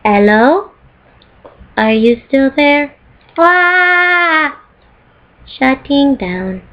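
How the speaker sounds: slow, drawn-out robot voice with a squeal; GLaDOS and turret impersonation. Retold or imitated. Imitating the turret to her viewers, slow robotic voice. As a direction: play the sad turret powering down. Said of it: "That's my impersonation".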